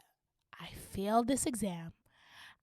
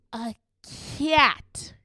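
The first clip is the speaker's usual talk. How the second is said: loud, higher-pitched burst; outraged reveal; 3 semitones higher, 6 dB louder than usual. Retold or imitated. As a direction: blurt it out loud in disbelief. Same word repeated in outrage.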